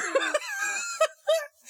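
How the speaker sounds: high wheezy laughing fit; wheezing laugh. Real, not acted.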